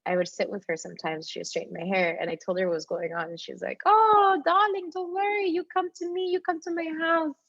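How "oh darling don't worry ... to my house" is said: much higher, doting mock voice; kind older lady. Kiosk lady comforting the girl, high and doting. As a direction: coo like a motherly lady. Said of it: "She's, like, this Israel lady".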